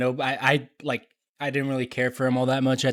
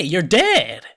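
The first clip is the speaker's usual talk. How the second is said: louder, held-out snap; imitating a harsh old doctor, louder and drawn out; angrier repeat; 5 semitones higher, 8 dB louder than usual. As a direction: hammer it home louder the second time. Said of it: "And she's like".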